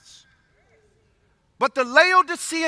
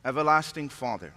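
quiet prayer; 10 semitones lower, 9 dB quieter than usual. Acted. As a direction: pray softly and reverently, drawing out the pauses. Opening prayer before sermon.

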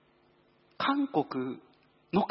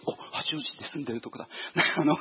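ordinary talk

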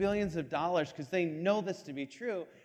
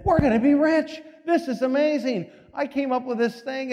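higher and louder, bursting with glee; excited; 7 semitones higher, 6 dB louder than usual. Retold or imitated. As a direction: burst in on your boss, thrilled. Scientist bursting in on his boss.

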